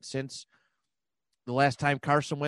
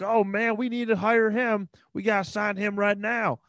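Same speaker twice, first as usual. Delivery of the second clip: higher-pitched, hammed-up Bills fan accent; mock an over-eager Bills fan, pitched up in their accent; 8 semitones higher than usual. Podcaster mocking Bills fans chasing free agents, Bills fan accent. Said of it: "spot on Bills impersonation".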